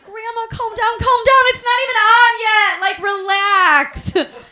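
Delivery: high, loud, alarmed begging; mock panic, voice pushed up high; panicked pleading. Grandchild telling grandma to calm down, panicked.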